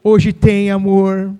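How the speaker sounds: loud, teasing wink of a line; hammed-up flirty promise to the husband; playful promise. Wife teasing her husband with a loud flirty promise.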